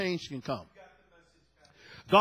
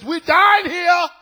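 shouted, slow and much higher, almost wailing; mayor crying out; 11 semitones higher, 12 dB louder, slower than usual. Retold or imitated. Preacher voicing the mayor of San Juan begging for help, desperate shout. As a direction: cry it out like a desperate plea for help. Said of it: "She says".

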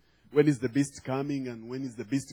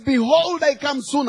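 very high, forceful pulpit cry; acted preacher declaiming under pressure; forceful proclamation; 10 semitones higher, 5 dB louder than usual. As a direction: throw your whole weight into it. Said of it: "he says it with a lot of force".

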